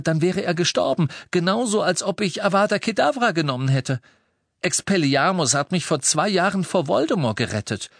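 heated, high-pitched self-defence; defiant. A narrator's reading. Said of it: "fügte Harry trotzig hinzu".

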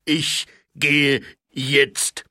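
very slow, loud troll voice; offended troll growling at the sergeant. Acted. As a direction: rumble each word like a troll. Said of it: "erwiderte Flussspat".

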